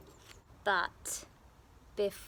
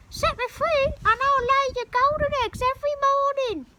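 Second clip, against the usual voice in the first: hen voice; 9 dB louder, faster than usual. Acted. Hen speaks.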